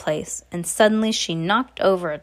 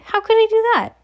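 high, loud bewildered question; astonished, high-pitched protest; incredulous cry; 6 dB louder, faster than usual. A narrator's reading. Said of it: "I cried".

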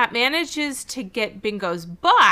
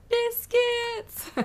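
high, drawn-out mimicked cry; mock exclamation. Retelling the dad's cry at the birth, high mock exclamation. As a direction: squeal it in imitation of the cartoon dad.

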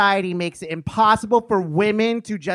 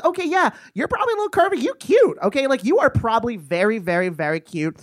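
mock catty woman; 3 semitones higher, faster than usual. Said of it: "they always".

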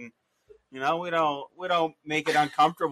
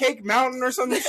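laughing. Real, not acted. Embarrassed laughing outburst.

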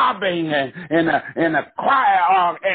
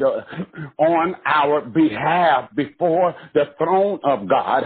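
ordinary delivery

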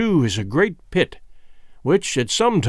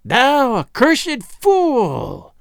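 high, very slow witch's curse; old witch cursing the king; slow shrieking curse; 8 semitones higher, much slower than usual. A narrator's reading. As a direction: drag the curse out like an old fiend. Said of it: "shrieked the old fiend".

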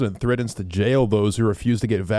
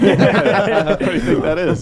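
higher and louder, slow through laughter; laughing; 6 semitones higher, 5 dB louder, slower than usual. Real, not acted. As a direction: ask it while cracking up. Laughing mock question.

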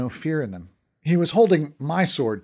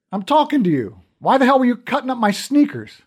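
higher, louder angry scolding; angry demand; 9 semitones higher, 5 dB louder than usual. A narrator's reading. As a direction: tower over him and demand an answer. Berating the frightened shoe thief.

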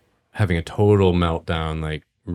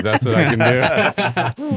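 slow words broken by real laughter, higher; laughing; 5 semitones higher, slower than usual. Real, not acted. [name] admits he can only quote Gabe, laughing. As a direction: chuckle through the self-mocking joke.

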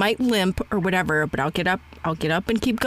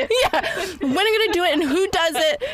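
laughing eagerly; 8 semitones higher than usual. Real, not acted. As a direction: giggle while firing off eager questions. Keeps laughing through questions.

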